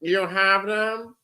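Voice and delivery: put-on slow, drawn-out German-accented villain; Hans Gruber. Villain demanding his detonators, slow German accent. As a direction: ham up a German movie bad guy. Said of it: "Hans Gruber".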